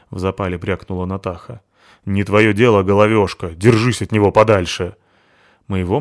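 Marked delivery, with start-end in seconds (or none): 2.0-4.9 angry warning. Acted. Натаха угрожает Абуте.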